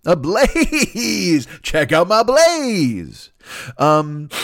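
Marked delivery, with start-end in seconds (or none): 0.1-3.3 slow, hammed-up gawker with a laugh; mock gawker. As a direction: drag it out like a dazzled rubbernecker. Mocking gawkers at the fire.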